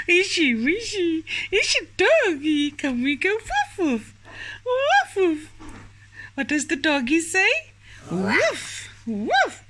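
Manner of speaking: put-on high, playful baby talk; reading to a toddler. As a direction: bark it out for a little child. Playing dog with toddler.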